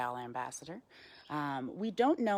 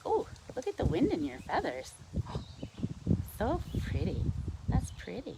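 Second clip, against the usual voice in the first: cooing to a pet; 8 semitones higher than usual. Real, not acted. Cooing to the owl.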